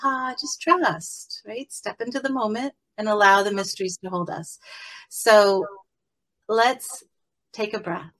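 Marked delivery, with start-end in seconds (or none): 0.0-1.4 slow, soft, soothing; calm reassurance. Retold or imitated. Answering her monkey mind, slow and soothing. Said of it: "And I'm like". 5.1-8.1 guided meditation. Acted. Leads a guided breathing meditation.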